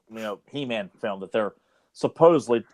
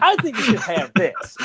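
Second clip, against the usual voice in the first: higher, slow put-on voice; mock Joe voice; 8 semitones higher than usual. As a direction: do a goofy slow impression.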